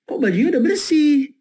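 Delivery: a mildly surprised wife. Retold or imitated. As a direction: act the wife's surprise. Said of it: "si ibu tuh kaget".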